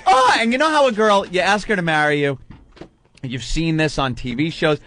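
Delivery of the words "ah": very high, loud mock shriek; delighted shriek. Imitating the bride's reaction, hammed-up shriek. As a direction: ham up the girl's overjoyed cry. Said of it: "She goes".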